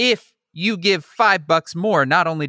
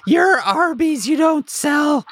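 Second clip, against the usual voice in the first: high, fussy, increasingly impatient; exasperated high customer; 11 semitones higher than usual. Acted. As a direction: correct the clerk, exasperated. Customer insists in skit.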